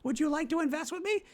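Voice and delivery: very high, loud hammed-up squeal; mock an over-eager stranger in falsetto; gushing networker. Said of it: "and they're like".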